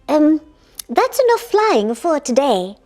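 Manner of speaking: ordinary talk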